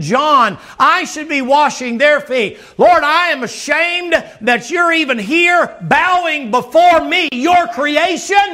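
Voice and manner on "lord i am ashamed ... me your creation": preacher hammering a penitent shout; shouted ashamed plea. Acted. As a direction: cry it out to the Lord in shame, stretching each word. Peter's ideal answer, preached hard.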